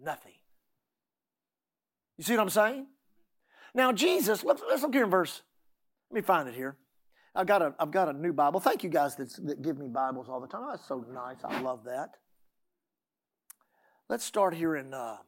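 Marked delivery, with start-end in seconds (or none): none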